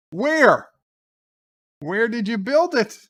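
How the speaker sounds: high, loud retold disbelief; incredulous questioning. Captain hears the ship is built, incredulous. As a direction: pitch leaps up in astonished demand.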